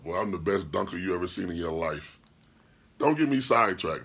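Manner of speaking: ordinary talk, nothing marked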